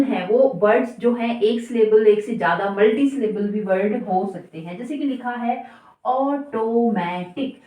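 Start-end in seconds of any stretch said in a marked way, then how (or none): 6.0-7.6 automatic broken slowly into four syllables. Shown as a demonstration. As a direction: say automatic in separate beats, ऑ-टो-मे-टिक. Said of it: "चार syllable से मिलकर बना है". (Automatic को अक्षर-अक्षर तोड़कर धीरे बोलें)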